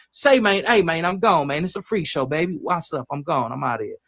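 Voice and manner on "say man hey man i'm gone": ordinary talk, nothing marked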